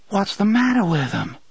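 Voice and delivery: high, hushed and horrified; soft horrified voice. A narrator's reading. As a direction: breathe it out in dawning horror. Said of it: "in a soft, horrified voice".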